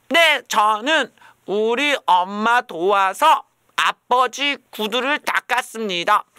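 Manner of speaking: high, loud, slow schoolkid introduction; hammed-up goody-goody kid; kid's recital. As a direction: recite it like a proud kindergartener. Child reciting good deed.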